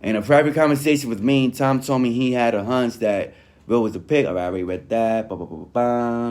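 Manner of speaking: ordinary talk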